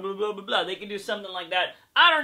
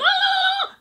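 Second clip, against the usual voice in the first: mock outraged cry; 6 dB louder than usual. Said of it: "And you're like".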